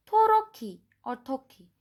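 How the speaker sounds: Toroki with a rolled tap r, then short Toki, both falling; Korean pronunciation. Shown as a demonstration. Teacher giving old and new Korean words for Turkey. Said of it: "This is the old way ... the new way".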